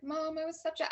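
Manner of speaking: high-pitched retold tearful plea; lightly acted crying apology; imitating crying son. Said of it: "starts crying".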